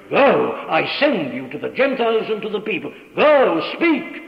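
issuing a command. Retold or imitated. Preacher voicing the command given to the apostle, loud and commanding. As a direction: proclaim it as a divine commission, punching "Go". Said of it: "was given the command".

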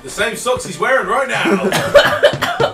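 slow and loud through laughter; laughing. Real, not acted. Friend teasing Ross about his socks, laughing loudly. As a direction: tease him while cracking up.

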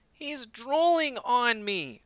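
high, loud, slow whine; slow high disgusted child whine; grossed-out complaint. A narrator's reading. Dot complains about drool.

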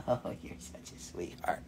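soft unvoiced whisper; whisper to dog. Real, not acted. Host whispers lovingly to her dog. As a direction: breathe it tenderly to the dog.

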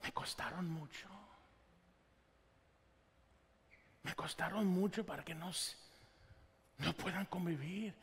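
softly, a father's quiet heartbreak; hurt parent, quiet. Retold or imitated. Father watching his children fight, quiet and pained. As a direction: murmur it low, like a father worn out by his quarrelling kids. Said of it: "y dices".

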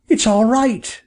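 high-pitched excited bird call; crying out. A narrator's reading. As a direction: cry out the good news, high. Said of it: "cried Bob".

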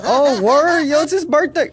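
high, loud, laughing outburst; laughing excitement. Real, not acted. Gamer spots someone's birthday in the lobby, excited. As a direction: burst out high and loud, amused.

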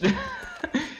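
high nervous giggle; scared laugh. Real, not acted. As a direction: laugh helplessly out of fear. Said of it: "I'm laughing because I'm scared".

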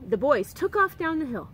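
ordinary delivery, nothing marked

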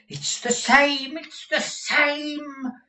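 very high, slow, drawn-out cry of recognition; excited cry. Acted. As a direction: cry it out, overcome by the discovery. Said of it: "cried Newman".

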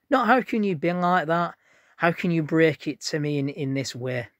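high, loud re-enacted protest; indignant reproach. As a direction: act out an outraged demand for explanation. Acting out his hurt reproach to her.

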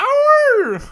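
very high loud yelp; dog yelp. Retold or imitated. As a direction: squeal like a hurt dog. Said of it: "the dog goes".

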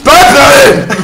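high, loud one-word shout; playful yelling. Real, not acted. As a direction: yell the silly word out loud.

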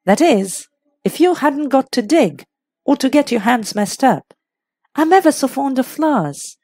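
lightly voiced young actress. A narrator's reading. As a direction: muse idly with a qualifying afterthought.

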